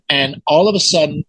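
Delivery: normal talking voice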